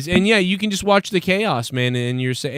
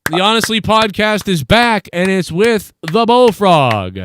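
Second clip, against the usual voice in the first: high, drawn-out announcer voice; show announcer; 4 semitones higher, 4 dB louder than usual. Acted. Host introducing his new co-host, high drawn-out announcer call. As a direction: announce it like a headline act.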